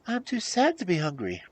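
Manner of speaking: high, plaintive voice; sad princess. A narrator's reading. Princess too sad for supper, plaintive.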